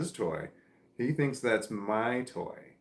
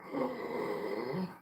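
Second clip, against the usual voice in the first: a soft, sharp intake of breath at the price; quick breathy gasp; shocked gasp; 4 semitones higher, 6 dB quieter than usual. Retold or imitated. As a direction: gasp in surprise. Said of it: "and I was like".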